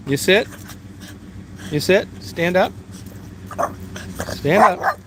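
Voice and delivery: high commands to a dog; bright coaxing trick commands; pet talk. Real, not acted. Making Daisy sit and beg for a treat, high coaxing pet commands.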